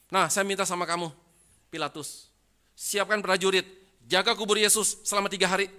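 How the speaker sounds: loud, high-pitched acted demand; forceful demand. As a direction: press the governor hard, at full volume. Priests demanding guards from Pilate.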